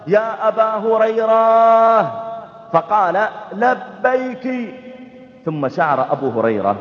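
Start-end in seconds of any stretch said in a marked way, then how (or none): 0.1-2.0 long, drawn-out call to someone far off. Retold or imitated. Mother calling her son Abu Hurayra, drawn-out call. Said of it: "نادته أمه يوما". 3.5-4.7 high, long-drawn answering call; slightly raised answer. Retold or imitated. Son answering his mother's call, high and drawn-out. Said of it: "أن صوته أرفع من صوت أمه قليلا".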